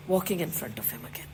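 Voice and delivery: whispered with longing; a devotee's longing whisper; hushed. A narrator's reading. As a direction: breathe it out softly, in awe. Longing for the boys.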